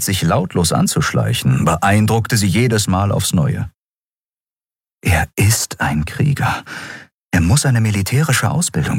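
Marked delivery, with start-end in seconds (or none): none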